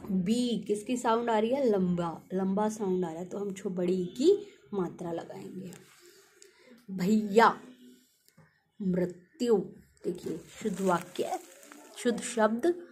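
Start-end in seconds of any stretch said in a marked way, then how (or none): none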